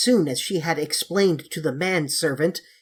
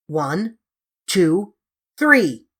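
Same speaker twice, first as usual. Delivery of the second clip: counted yell; 2 semitones higher, 5 dB louder, much slower than usual. Acted. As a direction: count up to a full scream. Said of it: "Now all scream when I say three".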